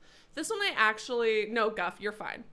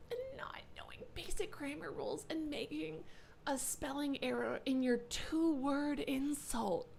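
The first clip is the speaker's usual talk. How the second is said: whispered mockery; 4 semitones higher, 10 dB quieter than usual. Real, not acted. Whispering mockery of misspelled insult.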